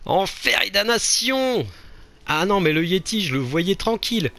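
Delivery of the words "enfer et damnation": high, drawn-out theatrical curse; mock cursing. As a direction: curse fate slowly and grandly in a high voice. Mock curse after dying.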